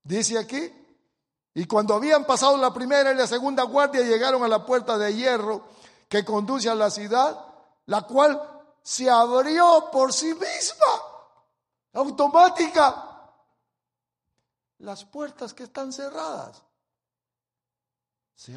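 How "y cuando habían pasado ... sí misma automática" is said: loud, high pulpit reading; forceful scripture reading. Acted. Preacher reading the verse aloud to the church, loud proclamation.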